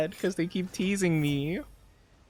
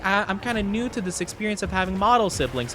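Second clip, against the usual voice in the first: usual speaking voice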